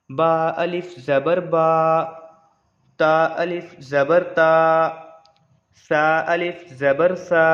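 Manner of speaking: slow, drawn-out spelling chant; measured Qur'an-school recitation; Qaida recitation. Shown as a demonstration. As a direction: recite each letter slowly for the class. Recites the Qaida line slowly.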